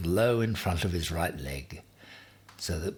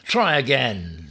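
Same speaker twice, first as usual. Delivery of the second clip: slow, louder command; firm order; 5 semitones higher, 7 dB louder than usual. A narrator's reading. Ham pushes porcupine again: slow firm order. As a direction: drag it out firmly.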